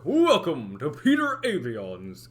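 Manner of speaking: slow, grand carnival announcer. Shown as a demonstration. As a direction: welcome them like a showman. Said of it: "trying to talk like an announcer".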